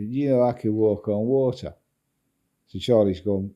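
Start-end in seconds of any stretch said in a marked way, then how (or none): 0.1-1.7 put-on light Manchester accent, a bit higher, boastful. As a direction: imitate a drunk Mancunian mate bragging in his Manchester accent. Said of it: "in his Manchester accent".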